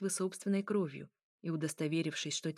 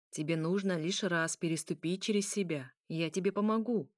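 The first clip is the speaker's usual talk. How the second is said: ordinary delivery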